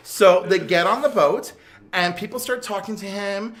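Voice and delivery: usual speaking voice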